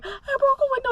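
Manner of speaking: high, teary voice cracking with sobs; imitating her own sobbing self. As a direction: blurt it out mid-sob. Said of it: "Sobbing, sobbing".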